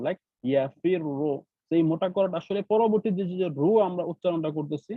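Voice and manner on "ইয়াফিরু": ইয়াফিরু slowly, last ru heavy; slow recitation demo. Shown as a demonstration. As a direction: stretch ইয়াফিরু and make the final ru heavy. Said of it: "তখন আমরা মোটা করে পড়বো".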